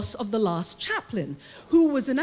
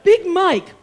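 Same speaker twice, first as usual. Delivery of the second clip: bright call; 7 semitones higher, 10 dB louder than usual. Retold or imitated. Calling out to an inmate.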